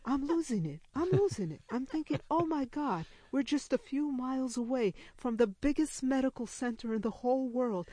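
high, acted panic; rising near-hysterical inner rant; losing it. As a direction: wail it like someone at the end of their rope. Retold inner panic at the absurdity.

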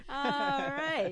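high, loud laughing all right; laughing at the mess; laughing resignation. Real, not acted. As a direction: resign yourself while laughing. Bird pooped on sock.

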